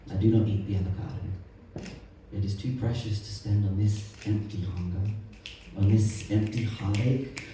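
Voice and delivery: quiet poem reading. Acted. Poet reading a protest poem to the audience, quiet and controlled. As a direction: lay out each hard fact softly and evenly.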